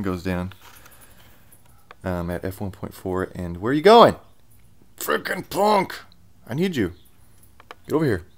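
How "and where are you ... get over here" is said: much louder, higher call-back; commanding a dog. Real, not acted. Calling the running dog back.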